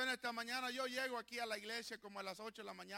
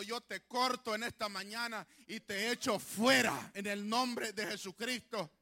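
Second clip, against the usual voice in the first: usual speaking voice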